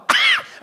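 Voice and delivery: sharp snort of snot clearing the throat; a mock snort clearing the throat. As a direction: hack it out in one burst.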